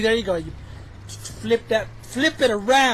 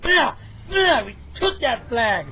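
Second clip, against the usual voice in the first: usual speaking voice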